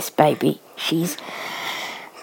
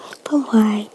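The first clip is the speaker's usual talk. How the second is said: whisper. Real, not acted. Mother coaxing baby to open her mouth, whispering.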